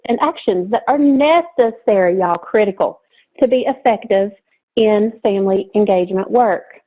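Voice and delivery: normal talking voice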